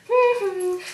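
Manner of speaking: very high hummed call; high two-note hum; repeated hum. Retold or imitated. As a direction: imitate an annoying, persistent high hum. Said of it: "she kept doing it".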